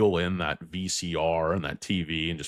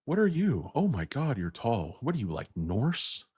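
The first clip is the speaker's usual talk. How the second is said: lightly acted, higher-pitched movie quote; swooping higher voice, sizing someone up; quoted film character; 6 semitones higher than usual. Quoting a Best in Show line, lightly put-on film character voice. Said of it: "another good line from Best in Show".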